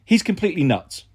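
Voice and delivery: ordinary delivery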